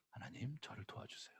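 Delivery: 하나님 whispered with a falling close; whispered plea. Shown as a demonstration. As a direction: whisper it as a humble prayer. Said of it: "먼저 감정을 얘기를 해야 되는 거예요".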